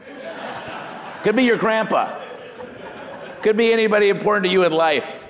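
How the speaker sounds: amused at the misunderstanding, chuckling; laughing. Real, not acted. Laughing at trainee's literal reply.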